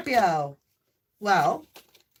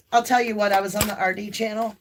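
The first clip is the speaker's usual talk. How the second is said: ordinary talk, nothing marked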